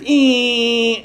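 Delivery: high sustained imitated whine; thin electronic whistle done by mouth; hearing aid squeal. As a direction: mimic feedback with one long high tone. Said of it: "the hearing aids go off".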